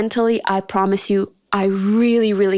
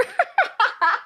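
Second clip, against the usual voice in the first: a high disbelieving burst of laughter; incredulous laugh; 13 semitones higher than usual. Real, not acted. Laughing at the clueless classmate's stupid question, high. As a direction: laugh at how stupid it was. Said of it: "I had to laugh".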